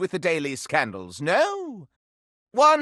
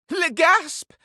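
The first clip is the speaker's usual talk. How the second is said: theatrical gasp; 10 semitones higher than usual. Acted. Candelabra shocked by news.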